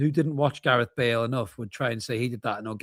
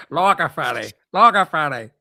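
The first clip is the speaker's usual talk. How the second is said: put-on comic voice, rising louder; imitated comic quote; 3 semitones higher, 6 dB louder than usual. Repeating the remembered Zippy joke, imitated comic voice. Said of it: "And he goes".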